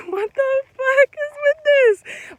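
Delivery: laughing half-scream of shock; shocked shout. Retold or imitated. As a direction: blurt out total disbelief. Shocked at rent-free offer.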